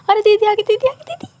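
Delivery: very high, loud panicked yell; sudden scared scream; startled shriek. Real, not acted. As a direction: shriek as she appears. Ghost suddenly appears.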